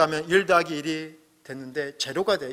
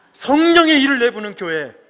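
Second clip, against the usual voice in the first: loud, higher Gyeongsang dialect punchline; 8 semitones higher, 11 dB louder than usual. Pretend. Pastor delivering the pun to the congregation in loud Gyeongsang dialect. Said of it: "제가 좀 사투리를 써보자면".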